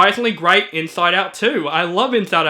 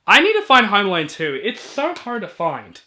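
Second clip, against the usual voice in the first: ordinary delivery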